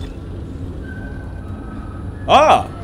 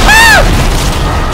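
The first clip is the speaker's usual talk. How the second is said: very high piercing scream of fright; shrill scream; 6 dB louder than usual. Real, not acted. Gamer attacked by a monster lets out a piercing scream. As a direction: shriek as the monster hits.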